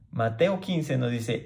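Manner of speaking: usual speaking voice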